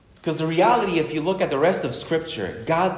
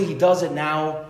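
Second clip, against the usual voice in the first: normal talking voice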